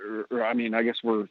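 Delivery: ordinary delivery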